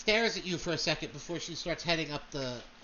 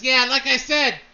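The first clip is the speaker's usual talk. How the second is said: much louder, higher dismissive call; Blackthorn insisting; 6 semitones higher, 11 dB louder than usual. Acted. Noble shouting down at Devin to get in line, loud and dismissive. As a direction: brush them off loudly from above. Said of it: "He says".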